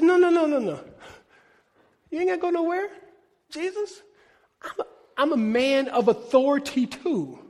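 lightly voiced centurion; centurion pleading. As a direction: stop him, eager and humble. Centurion voiced stopping Jesus.

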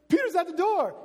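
high, loud, thrilled cry; bursting in with the news; excited announcement. Acted. Servant girl telling the praying crowd, excited cry. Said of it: "She says".

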